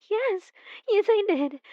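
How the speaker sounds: proud of the crime, bursting out; gleeful confession. Acted. Obsessed admirer to beloved, gleeful confession.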